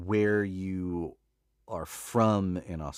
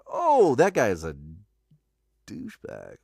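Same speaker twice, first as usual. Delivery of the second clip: exaggerated change of opinion, pitched up; mock realization; 4 semitones higher, 7 dB louder than usual. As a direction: act out suddenly catching on. Acting out listener's later realization.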